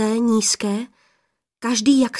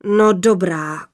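slow, heavy giving-in; relenting father; 4 dB louder, slower than usual. Acted. Cloud lord accepts his daughters' excuse, relenting. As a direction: concede slowly as the big sky father.

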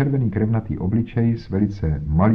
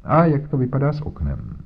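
higher, self-satisfied bluster; pompous inspector; 5 semitones higher than usual. Acted. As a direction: lecture Holmes with condescension. Voiced inspector Jones talking. (nafoukaný inspektor, výš posazený hlas)

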